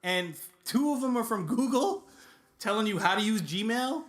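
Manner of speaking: high, loud comic rant; overplayed indignant desperation; mock desperation. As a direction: plead in rising exasperation. Mock pleading about empty inbox.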